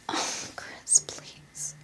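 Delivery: whispered aside to partner; whispered please; hushed plea. Real, not acted. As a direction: hush someone off-mic, pleading softly. Hushing partner during recording.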